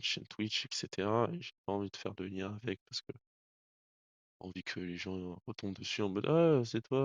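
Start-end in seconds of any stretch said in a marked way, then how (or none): 6.3-7.0 higher, louder drawn-out "ah"; mock surprised recognition. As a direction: imitate someone suddenly recognising you. Imite des gens qui le reconnaîtraient. (surprise feinte, imitation de quelqu'un qui vous reconnaît)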